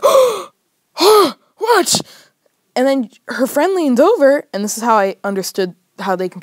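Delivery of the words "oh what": high, startled gasp of joy; stunned delight. Retold or imitated. She agreed to a date, high stunned gasp. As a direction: gasp and squeak in disbelief.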